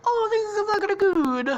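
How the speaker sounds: very high, loud put-on voice; high character voice. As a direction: squeal it in a high cartoon voice. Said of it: "he's like".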